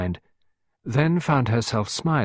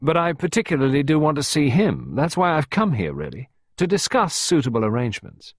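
a touch of smug, amused insolence; lightly enjoying needling her; insolent amusement; 7 semitones higher, 3 dB louder than usual. Acted. Costello to Clarissa, mildly insolent and amused. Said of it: "with rather insolent amusement".

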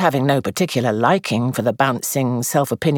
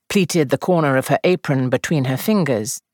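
plain reading voice

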